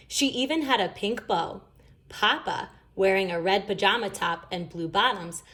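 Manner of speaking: ordinary delivery, nothing marked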